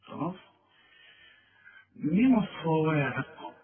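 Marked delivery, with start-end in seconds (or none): 2.0-3.5 من الصواعق slow with stress on من; tajweed demo of من الصواعق; slow recitation. Shown as a demonstration. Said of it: "تعرف تقولها زي ما أنا قلتها".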